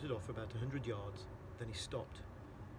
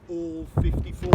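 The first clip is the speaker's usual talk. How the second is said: louder on fifty-four; booming emphasis; 4 semitones higher, 13 dB louder than usual. A narrator's reading. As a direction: boom out the size. Narrator booms the size.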